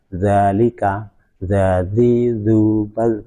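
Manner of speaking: zalika slow, with a light, flat tongue; demonstrating light dhāl; drawn-out zal demo. Shown as a demonstration. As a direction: stretch each zal word slowly. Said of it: "Sementara zal".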